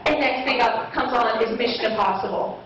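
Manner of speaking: usual speaking voice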